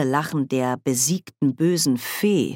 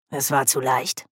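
grummelnd. A narrator's reading. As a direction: grumble it with a touch of discontent. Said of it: "grummelte Oma Wetterwachs". (leicht grummelnd)

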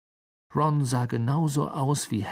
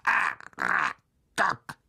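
tiefer, sehr langsamer Ruf mit Anstieg; tiefer gedehnter Spinnenruf; 9 semitones lower, much slower than usual. Acted. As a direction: zieh den Namen tief und langsam. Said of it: "rief sie". (tiefer langsamer Spinnenruf)

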